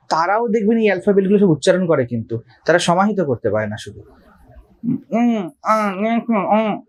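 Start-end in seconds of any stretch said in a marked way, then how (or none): 5.1-6.8 humming um and aa like someone who cannot speak; a light demo of mumbled nasal sounds; imitating a mute person. Shown as a demonstration. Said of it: "এভাবে বলছে না কথা".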